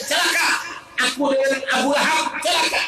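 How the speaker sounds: furious shouting woman cursing; furious rant. Acted. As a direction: rage at the curse, shouting. Ummi Jamil raging at the verse.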